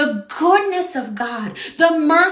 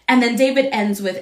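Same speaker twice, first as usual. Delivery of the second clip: usual speaking voice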